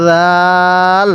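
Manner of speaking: slow recitation-drill রাল, said zaal; drawn-out letter name. Shown as a demonstration. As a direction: stretch রাল slowly on one level note. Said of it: "এরকমভাবে পড়তে হবে".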